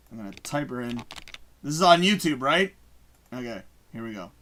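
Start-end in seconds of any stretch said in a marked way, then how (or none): none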